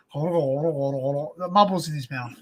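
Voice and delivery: low, slow mumble as if mouth full of marbles; marbles-in-mouth talk. Retold or imitated. Nephew showing how his uncle talks, marble-mouthed. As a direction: slur it slowly, imitating a marble-mouthed talker. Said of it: "He talks that".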